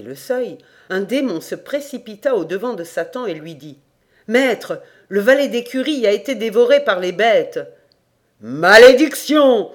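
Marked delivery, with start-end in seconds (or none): 4.3-7.6 loud, urgent report to the master; raised-voice demon messenger; alarmed demon. A narrator's reading. Demon rushes up with bad news. 8.4-9.7 huge, drawn-out roar of rage; frightening devil's bellow; terrifying curse. A narrator's reading. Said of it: "d'un ton si effrayant".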